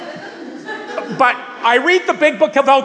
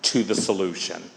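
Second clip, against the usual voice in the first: a soft loving hush; 14 semitones lower, 6 dB quieter than usual. Shown as a demonstration. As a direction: lower and softer, gently coaxing. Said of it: "in a nice, soft, loving way".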